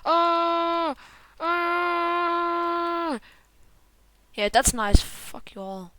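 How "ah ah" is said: sustained, steady "aah"; drawn-out monotone wail; long held scream. Real, not acted. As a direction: hold the cry on one note, then drop. Held cries during game.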